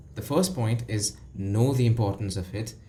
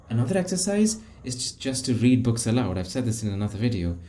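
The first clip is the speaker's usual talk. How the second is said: ordinary talk, nothing marked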